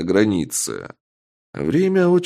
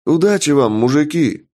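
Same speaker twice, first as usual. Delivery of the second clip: calling out; 6 semitones higher, 4 dB louder than usual. A narrator's reading. Guards calling out farewell.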